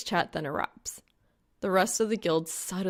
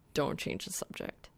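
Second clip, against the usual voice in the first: stern whisper; 4 semitones lower, 7 dB quieter than usual. A narrator's reading.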